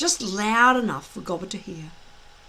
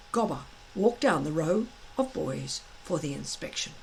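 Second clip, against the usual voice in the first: plain reading voice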